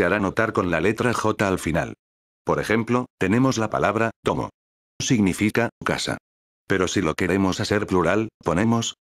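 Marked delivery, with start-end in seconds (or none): none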